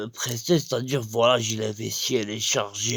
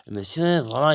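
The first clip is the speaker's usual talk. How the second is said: ordinary talk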